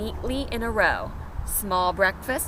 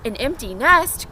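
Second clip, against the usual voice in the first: excited cry; 4 semitones higher, 6 dB louder than usual. Acted. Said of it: "cried the chicken".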